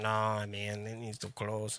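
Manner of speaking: put-on low, quiet, botched German accent; German accent that drifts toward Mexican; bad German accent. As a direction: attempt a German accent and miss. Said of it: "That was my best German accent".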